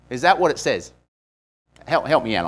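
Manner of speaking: usual speaking voice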